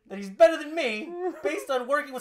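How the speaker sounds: high, loud wounded-pride tirade; mock-furious promo; outraged rant. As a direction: protest hotly as an insulted wrestler. Acting Rusev's rant about Roode.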